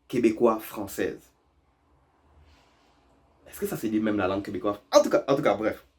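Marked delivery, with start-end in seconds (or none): none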